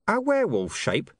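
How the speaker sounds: deadpan, no interest in it; flat disbelief. A narrator's reading. Deep Bone answers William flatly, deadpan. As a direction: flatly repeat it back. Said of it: "said Deep Bone flatly".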